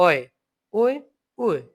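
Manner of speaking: umlauts said with a glide, "অয়, ওয়ে, ওয়ে"; others' umlaut pronunciation. Shown as a demonstration. Teacher imitating how many people say umlauts, glided pronunciation. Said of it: "যেরকম অনেকে বলে".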